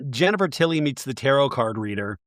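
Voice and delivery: normal talking voice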